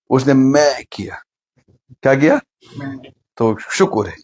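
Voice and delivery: ordinary talk, nothing marked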